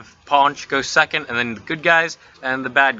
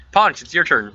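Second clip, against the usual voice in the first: normal talking voice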